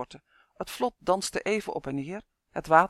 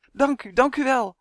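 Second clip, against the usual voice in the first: high, loud overjoyed thanks; calling out; 7 semitones higher, 7 dB louder than usual. A narrator's reading. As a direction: exclaim it with overflowing gratitude. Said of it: "riep hij wel honderd keer". (uitbundig roepen)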